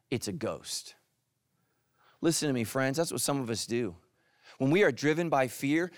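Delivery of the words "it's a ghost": mock fearful cry, much lower and softer; a hushed, spooked mock cry; scared disciples. Pastor voicing terrified disciples in the boat, hushed mock fear. And they cried out.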